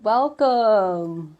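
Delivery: usual speaking voice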